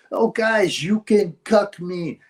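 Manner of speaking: high, slow, simpering mock voice; a drawn-out mocking whine; mock meek Otto. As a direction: send him up as a pushover.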